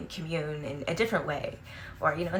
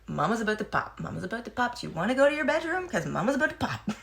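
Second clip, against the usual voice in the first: louder sing-song mom warning; mock warning; 5 semitones higher, 4 dB louder, faster than usual. Mom warning her kids she's about to pop, louder and playfully stern. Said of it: "I give fair warning every time".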